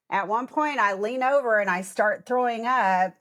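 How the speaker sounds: ordinary talk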